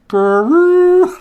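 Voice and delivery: high, loud horn blast in a friend's face; mock horn toot; imitated Viking horn. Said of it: "got near his face and went".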